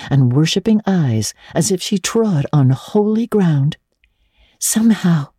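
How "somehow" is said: put-on higher, eager girl's voice; Anne. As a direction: lift into young Anne, dreamy. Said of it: "she told Diana".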